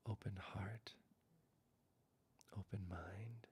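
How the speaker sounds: slow, soft, whispered meditation voice; hushed closing meditation, words spaced out; guided meditation. Acted. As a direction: ease them into rest, barely above a whisper.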